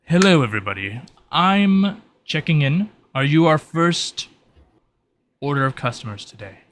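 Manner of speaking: polite shopkeeper voice. Acted. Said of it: "and they're like".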